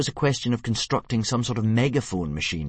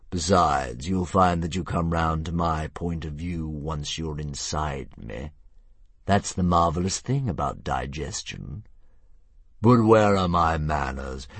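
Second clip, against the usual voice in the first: slow, grand dragon; a lazy monster toying with prey; 3 semitones lower, 3 dB louder, slower than usual. Acted. Narrator voices the dragon.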